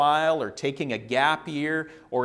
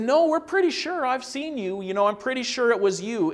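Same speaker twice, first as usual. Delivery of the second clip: much higher acted accuser voice; insistent accusation; 8 semitones higher, 4 dB louder than usual. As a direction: press the point in a raised pitch. Acted accusers insist once more.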